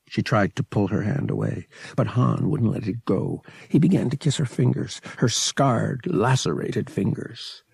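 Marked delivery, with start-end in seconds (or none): none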